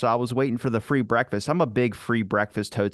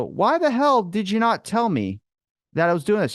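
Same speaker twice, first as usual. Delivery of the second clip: indignant, call your buddy out; mock outrage; 10 semitones higher, 3 dB louder than usual. Angry at buddy's secret plans.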